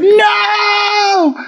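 a high, shouted retold no; shrill mock cry of no; mock scream. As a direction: scream it like the trapped heroine. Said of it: "she's like".

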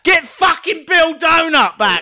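loud, high, slow yell; bellowing for the character to return; shout. Real, not acted. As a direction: yell it at him. Shout to co-host.

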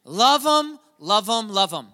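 high, loud, ringing preacher's shout; God's urging. Retold or imitated. Pastor voicing God telling him to love Zach, loud high shout. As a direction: let it ring out like a divine command, building. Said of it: "Felt God kept saying".

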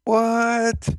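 high, stunned shout of disbelief; shocked what. Retold or imitated. Hearing the same prophecy from a stranger, high and stunned.

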